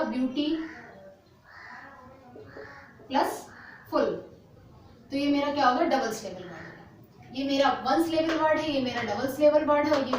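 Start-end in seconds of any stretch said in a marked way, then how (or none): 0.0-4.0 "ब्यूटी", long pause, "plus", then "फुल" on its own; split into parts. Shown as a demonstration. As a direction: set out "ब्यूटी" and "फुल" as separate pieces joined by "plus". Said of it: "यहाँ पे मैंने क्या जोड़ा".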